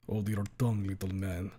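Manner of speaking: low, quiet Russian menace; Russian accent. Acted. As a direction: growl it softly, Russian. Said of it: "Russian accent teacher".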